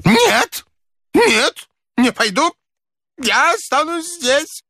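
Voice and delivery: put-on high, panicky old man; very high-pitched frightened protest; exclaiming refusal. A narrator's reading. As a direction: cry out a stubborn refusal. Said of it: "воскликнул незнакомец".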